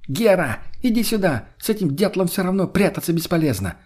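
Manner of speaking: высокий раздражённый оклик, не во весь голос; зови высоко, будто через всю стоянку; окрик через стоянку. A narrator's reading. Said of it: "крикнула ему через всю стоянку". (высоко, с раздражением подзывает)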